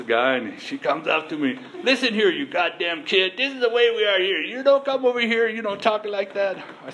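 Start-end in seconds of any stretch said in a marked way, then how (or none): 1.8-6.6 higher-pitched mock scolding from an old lady; lightly voiced old lady telling off a kid.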